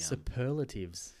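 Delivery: slow, drawn-out superlatives; savouring a word. Real, not acted. Friend trying out a new word, superlatives said slowly. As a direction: stretch each syllable of superlatives.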